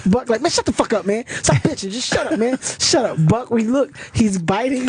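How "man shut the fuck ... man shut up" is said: high-pitched mock annoyance; imitated exasperated snapping back; irritated retort. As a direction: act out your friend shutting him down, pitched up. Friend snaps back while lying arrested.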